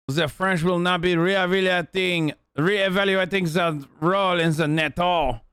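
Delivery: hammed-up French accent; mock French accent. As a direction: imitate a proud Frenchman making a statement, heavy French accent. Voices a French statement mockingly.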